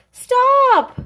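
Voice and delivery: very high, very loud Stop!; desperate yell. Acted. Big Anthony yells at the overflowing pot, desperate loud shout. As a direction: scream it at the runaway pot. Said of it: "yelled Big Anthony".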